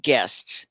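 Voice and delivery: GUESTS loud, in a single beat; one syllable. Shown as a demonstration. Teacher gives the rule to students, one syllable. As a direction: close GUESTS on a full "sts". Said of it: "it's always one syllable".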